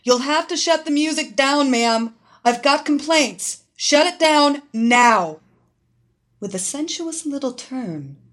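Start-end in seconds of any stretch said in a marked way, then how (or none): 0.0-5.4 loud, fast commanding shout; shouting over music. Acted. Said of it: "She shouted over the music".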